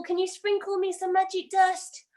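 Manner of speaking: ordinary talk, nothing marked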